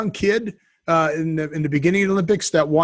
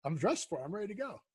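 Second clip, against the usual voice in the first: faint, dropping-out connection; fading in through static; 2 semitones lower, 7 dB quieter than usual. Real, not acted. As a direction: voice cutting in and out. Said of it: "your voice is fading in and out".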